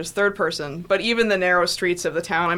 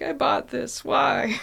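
mock despair; 6 semitones higher, much slower than usual. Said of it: "I was like".

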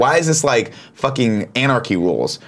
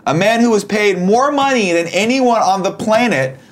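higher, slow game-show build-up; hyped reveal build-up; 9 semitones higher, slower than usual. Acted. Teasing the co-host before the reveal, slow hyped announcer tone. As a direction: drag out the suspense like an announcer.

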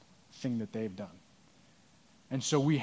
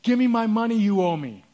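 higher, louder demand, building; angry demand; 6 semitones higher, 5 dB louder than usual. Retold or imitated. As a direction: bark it at him, shaking him. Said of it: "grabs him by the throat and says".

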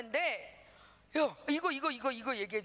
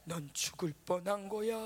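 low ominous taunt; haunting inner voice; 5 semitones lower than usual. Retold or imitated. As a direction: say it low like a voice in the head. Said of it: "자꾸 이 소리가 들리니까". (낮은 불길한 속삭임 같은 목소리)

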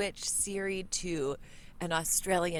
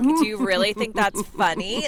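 put-on louder, judgy Australian accent; Australian Siri judging; 9 dB louder than usual. Australian Siri reading her text back, sceptical Australian. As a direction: throw shade in an Australian voice. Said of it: "he reads you like".

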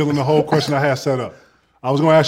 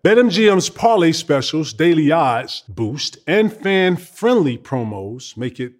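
ad read; 2 semitones lower than usual. Acted. Host reading the sponsor ad to listeners, upbeat ad read. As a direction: sell the bonus like a radio spot.

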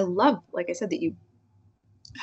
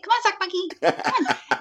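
put-on much higher, laughing baby talk; baby talk to dog; 11 semitones higher than usual. Owner calling her dog on a walk, high laughing baby talk. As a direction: coo at the old bulldog like a toddler. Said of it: "I even say it".

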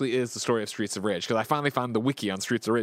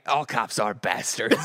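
slow movie line; 5 semitones lower, slower than usual. Retold or imitated. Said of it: "and then it's like".

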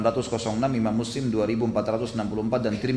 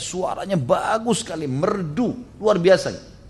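higher-pitched, impressed retelling; retold admiration; 6 semitones higher, slower than usual. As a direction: marvel at a beautiful voice, retelling it. Retelling Aisyah's admiration of a recitation.

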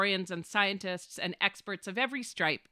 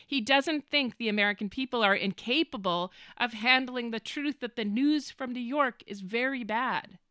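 ordinary delivery

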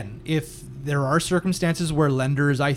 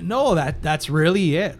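ordinary talk, nothing marked

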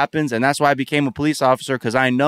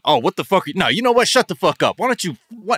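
mock hostile confrontation; 9 semitones higher, 4 dB louder than usual. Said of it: "if someone starts saying".

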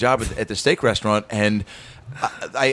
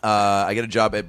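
ordinary talk

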